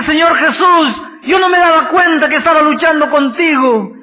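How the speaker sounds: loud, high, desperate shouted pleading; a man clinging on and shouting for a blessing; desperate plea. Acted. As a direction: beg with everything you have, crying out. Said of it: "se agarró de Cristo desesperadamente".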